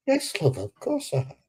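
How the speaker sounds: a mock-innocent reassurance to the missus; placating wife. Husband soothing his suspicious wife, mock innocent.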